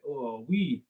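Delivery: imitated French interjection. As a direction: play at being French for a moment. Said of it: "that's what they say".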